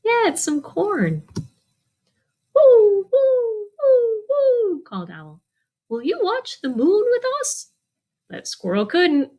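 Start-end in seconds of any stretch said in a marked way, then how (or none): 2.5-4.8 high, louder hooting; high owl hoots. Acted. Owl hoot in picture book. 5.9-7.7 much higher owl invitation; storyteller voicing an owl, high. Owl speaks in picture book.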